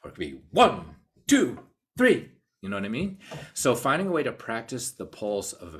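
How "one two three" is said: louder, rising higher to a bright three; loud count. Shown as a demonstration. Teacher showing a loud dynamic pulse. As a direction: count out big and strong. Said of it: "pulse could have dynamics too".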